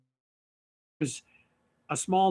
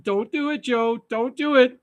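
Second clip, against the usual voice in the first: mock warning; 6 semitones higher than usual. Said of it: "he's like".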